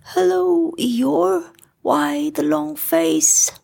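high, friendly little bear; Pooh bear voice. A narrator's reading. Pooh greeting his gloomy friend Eeyore, high and friendly. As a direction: lift it up high and kind.

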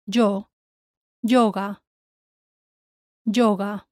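yo and Yoga with a hard Y, "jo"; Spanish YO. Shown as a demonstration. Teacher models for learners, Yoga opening on "jo". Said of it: "Notice how the Y O is".

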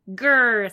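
loud storybook witch growl; loud "grrr" of an enraged witch; angry growl. A narrator's reading. Baba Yaga growls at the cat.

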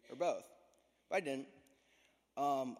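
ordinary talk, nothing marked

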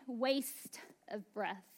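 slow, soft and drawn out; hushed slow verdict; slow drawn-out readout. Shown as a demonstration. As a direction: let each word hang like a gauge reading. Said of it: "maybe it would read".

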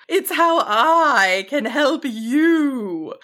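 big, kindly dragon voice; kind, loud dragon. A narrator's reading. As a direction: speak as a benevolent giant dragon. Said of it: "the dragon corrected kindly, if loudly".

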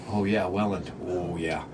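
low, soft knowing murmur; hushed, dropped-pitch recognition; imitated knowing locals. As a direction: say it low, as locals who know the place. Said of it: "let people from Ontario who listen to this podcast go".

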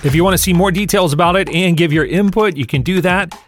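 energetic sponsor-style read over music; promo read. Acted. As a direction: pitch the community like a quick ad spot. Upbeat promo read before episode.

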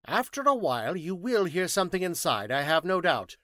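high, loud, emphatic scientist; precise, high-pitched orders; formal instructions. Acted. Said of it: "gave some formal instructions".

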